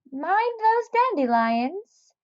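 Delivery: high, fussy neighbour's warning; Missus Seed Nibbler warning. Acted. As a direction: warn him like a bossy old neighbour. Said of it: "Missus Seed Nibbler warned".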